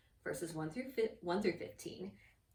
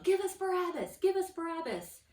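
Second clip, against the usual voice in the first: loud, high-pitched chant; crowd yelling; 10 semitones higher, 7 dB louder than usual. Retold or imitated. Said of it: "they kept yelling".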